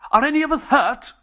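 called out high and loud; Benchley calling out to the others, high and loud. Acted. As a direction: call across to the other cells. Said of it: "called Benchley".

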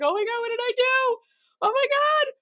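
high, far-off sobbing panic repeating oh my God; panicked crying. Retold or imitated. On the phone to her best friend, broke in Sydney: high tearful panic. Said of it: "I just started crying".

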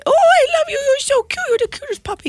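put-on very high, gushing baby talk; baby talk to puppy. Cohost showing how she greets random dogs, cooing high. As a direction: coo at the dog like it's a baby. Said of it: "And I, and I say".

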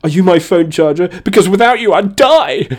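high, over-the-top needy plea; hammed-up clingy pickup line. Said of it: "I'd probably lean more clingy with that one".

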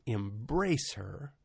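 lightly voiced gentle command, slow and spaced out; a hint of tender divine instruction; the Lord. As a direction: soften toward the end, pausing between phrases. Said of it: "And the Lord said".

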